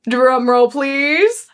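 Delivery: slow, drawn-out announcer tease; playful reveal build-up. Retold or imitated. Messenger about to reveal a new name, playful build-up. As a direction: stretch it out before the big reveal.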